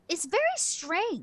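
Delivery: high, drawn-out character voice; slow it down and pitch it high; puzzled character. Acted. Doctor character examining the strange helmet, high puzzled voice. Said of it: "She goes".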